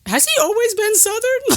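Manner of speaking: high-pitched mock-incredulous question; squeaky-high feigned astonishment; puzzled viewers. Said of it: "they're like".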